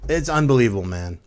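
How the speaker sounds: ordinary talk, nothing marked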